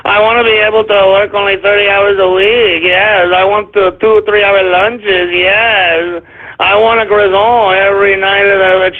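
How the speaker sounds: drawn-out whining mock-French accent; mock French whiner. French voters demanding short weeks and lunches, whiny mock French accent. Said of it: "The French frogs are gonna be like".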